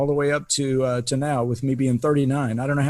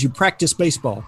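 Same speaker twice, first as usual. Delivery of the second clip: ordinary delivery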